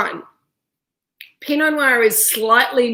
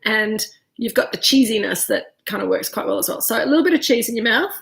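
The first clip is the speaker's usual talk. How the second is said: normal talking voice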